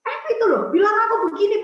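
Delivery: high, loud tattling quote; indignant complaining. Retold or imitated. Speaker mimics auntie complaining to the prayer group, indignant. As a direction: imitate someone complaining loudly to others.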